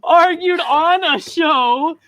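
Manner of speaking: ON A SHOW drawn out high and loud; loud, slow, exasperated clarification; emphatic correction. Real, not acted. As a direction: stretch out "Argued on a show" in protest. Exasperated correction of co-host's teasing.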